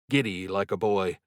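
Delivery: usual speaking voice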